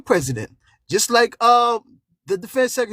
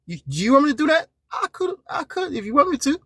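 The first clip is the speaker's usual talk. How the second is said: higher, fast mocking dare; taunting; 9 semitones higher than usual. Real, not acted. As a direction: goad him teasingly. Taunting offer to go.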